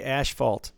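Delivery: "asphalt" said ash-falt, with "sh"; Detroit pronunciation. Shown as a demonstration. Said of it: "That's not right".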